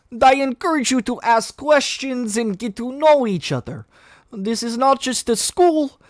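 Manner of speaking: sincere big brother welcome; Colossus voice. Acted. Colossus speaks to new students.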